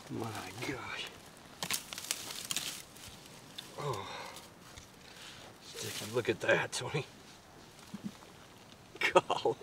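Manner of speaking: hushed, awed whisper over the animal; excited wildlife host whisper. Real, not acted. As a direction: breathe it out in quiet wonder. Hunter hushed over fresh kill.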